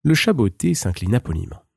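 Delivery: usual speaking voice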